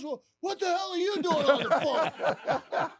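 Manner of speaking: loud, high, slowed mock outrage; hammed-up indignant yell; irate boss. Boss discovering the wrong man on the phone, irate. Boss retold turning angry.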